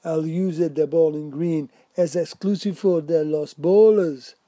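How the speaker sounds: stern park keeper in hammed-up Spanish. A narrator's reading. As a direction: lay down the rule sternly and loudly. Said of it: "gave him a stern glance and said".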